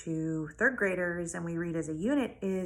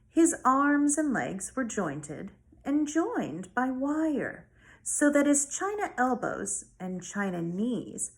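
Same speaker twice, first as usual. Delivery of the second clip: expressive read-aloud narration, a bit higher; story read aloud; 4 semitones higher, 4 dB louder than usual. Acted. Teacher reading a chapter to her students, lively storytelling.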